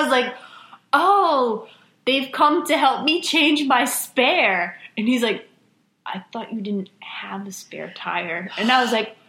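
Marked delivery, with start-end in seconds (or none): 0.9-4.8 high, slow, loud sing-song innocence; nervous fake cheer. Teen lying to the cop about the spare, high and slow with fake cheer. 6.1-8.5 whispered doubtful question; suspicious whisper. Retold or imitated. Cop catching the teen's lie, in a suspicious whisper.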